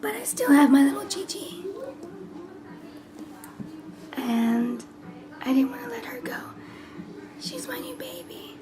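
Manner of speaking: hoarse near-whisper from a messed-up voice; talking in a whisper because her voice is shot; lost voice. Real, not acted. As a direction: whisper through a voice that's gone. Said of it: "I'm sorry my voice is messed up".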